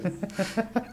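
soft high giggle; a quick breathy laugh at the punchline. Real, not acted. Telling the video joke, breaks into a high giggle.

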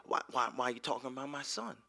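defensive. Retold or imitated. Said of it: "they respond and they say".

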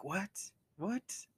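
high, questioning, rising "what?"; small-voiced puzzled disbelief; mock bafflement. Acts townsfolk's baffled reaction.

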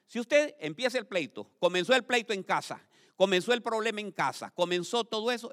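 loud run of repeated phrases; preacher warning about quarrels at home, loud; fervent preaching. Acted. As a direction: hammer each line. Preaching, building.

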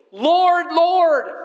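high, pleading call of "Lord, Lord". Retold or imitated. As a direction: cry out his name as if you know him. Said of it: "calling out his name".

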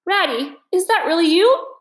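high, small weak voice; a tired little mole, hopeful; feeble voice. A narrator's reading. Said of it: "came a feeble voice saying".

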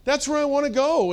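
put-on high, pleading protest like a stubborn kid; Paul pleading with God, high and whiny. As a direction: whine it up high, insisting on the town. Paul whining back at God.

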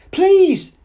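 very high, loud begging; piercing frightened appeal; desperate plea. A narrator's reading. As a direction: cry out for mercy. Woman begging while shaken.

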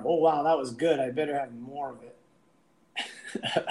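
mock greedy musing, slowing; greedy inner voice. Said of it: "I'm like a creature of more".

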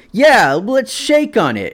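high, slow, slimy dad voice; high-pitched mock of a scheming father; smug dad. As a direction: play the sly dad sealing a trick deal. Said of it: "the dad was like".

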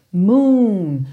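"Moon" with a long drawn oo. Shown as a demonstration. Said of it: "tem dois Os, quer dizer que esse som fica comprido".

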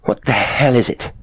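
high, rattled demand; shaken. Acted. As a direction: blurt it out still frightened.

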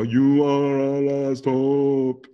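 high, slow, loud alien plea; Galaxy Quest alien. Pretend. Host quotes the Galaxy Quest alien begging for help, high and slow. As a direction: plead slowly like a hopeful alien. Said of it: "doing the alien from Galaxy Quest".

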